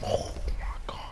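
breathless whisper of disbelief; exasperated whisper mid-fight. Real, not acted. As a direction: whisper it under your breath. Exasperated aside while fighting.

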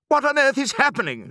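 very high-pitched, panicked shriek. A narrator's reading. Mother arriving amid flying birds, shrieked. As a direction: shriek it in alarm without much extra volume. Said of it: "she shrieked".

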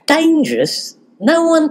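a high alarmed question; shocked at the word dangerous. Acted.

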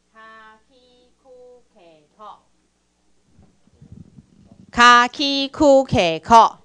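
loud and slow, syllable by syllable; slow syllable demo. Shown as a demonstration. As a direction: spell it out slowly for learners. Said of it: "这个卡，". (慢慢大声示范读音)

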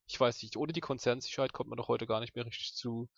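normal talking voice

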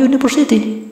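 "university" begun with a consonant y, "yu-niversity"; initial Y sound. Shown as a demonstration. Teacher explaining the consonant sound, "university" starting with y. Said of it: "cara bacanya itu diawali dengan Y".

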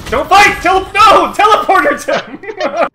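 frantic high yelled orders; yelling. Real, not acted. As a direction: bellow at your teammate to stop. Yelling orders at teammate Tim.

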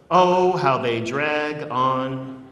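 slow, drawn-out lament; dragging. Shown as a demonstration. Poet recites a tiny poem about long poems, dragging it out. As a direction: stretch the words so they drag. Poem drags out its own words.

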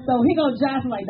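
ordinary talk

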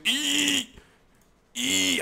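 tiny high "ee" squeak; little strained squeal. Real, not acted. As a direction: squeak like Mini-Me. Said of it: "I'm even making ridiculous guttural sounds".

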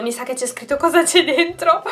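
usual speaking voice

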